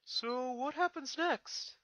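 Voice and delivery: high, very slow question; curious young dragon. Acted. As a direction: ask gently what comes next. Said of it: "he asked".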